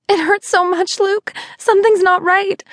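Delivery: high, frightened cry of pain; labouring mother, high and scared, to her best friend; pained plea. Acted. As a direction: plead that something is wrong.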